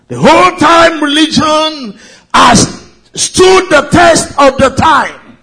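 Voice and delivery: rising fervent sermon voice; preaching. Acted. Preaching at full force.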